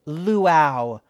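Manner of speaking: put-on loud, haughty drunken correction; drunk mother correcting. A narrator's reading. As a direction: correct her loudly and drunkenly.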